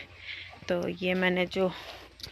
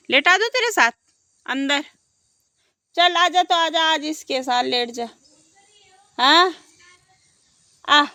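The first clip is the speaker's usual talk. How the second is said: coaxing call; 7 semitones higher, 9 dB louder than usual. Real, not acted. Coaxing the cow to lie down.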